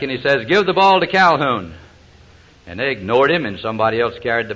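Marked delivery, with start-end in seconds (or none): 0.5-1.7 raised, higher retold sideline call; retold coach yelling an order; coach's yell. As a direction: call it over to the quarterback like a coach. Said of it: "he yelled over to the quarterback".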